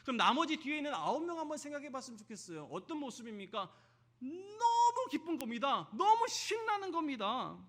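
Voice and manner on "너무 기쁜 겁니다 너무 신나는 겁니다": excited joy. Acted. Acting out the healed lepers' joy.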